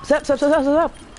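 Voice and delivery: high, teasing repeated sup; taunting. Pretend. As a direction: taunt the enemy playfully. Taunting enemy in game.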